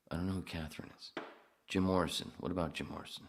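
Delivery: ordinary delivery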